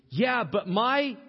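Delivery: high, loud, drawn-out objection; whiny excuse. Acted. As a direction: stretch it out like a complaining follower. Acted excuse people make to following.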